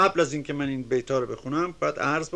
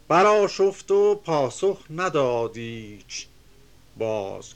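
measured, chanting reading of epic verse; reciting classical Persian verse; poem recitation; 4 dB louder, slower than usual. Acted. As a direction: declaim the couplets with rhythm.

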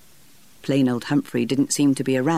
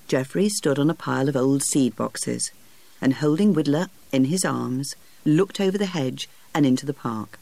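plain reading voice

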